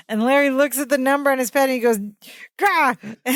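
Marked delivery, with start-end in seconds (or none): 2.6-3.0 high mock "gah!" of frustration; frustrated grunt. Recapper imitating Larry crumpling the paper, frustrated outburst. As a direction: burst out in imitated exasperation. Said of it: "throws it in frustration".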